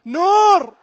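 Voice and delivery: high, loud cry of the name; one anguished shout; desperate cry. Real, not acted. Commentator crying out to the keeper, desperate and loud. Pleading as penalty is taken.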